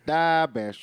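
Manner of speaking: Chicago accent. Shown as a demonstration. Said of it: "I just say".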